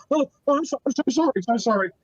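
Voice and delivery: high, stumbling apology from a rattled barkeeper; flustered apology. Acted. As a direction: realize your mistake and apologize hastily. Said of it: "he finally realizes and says".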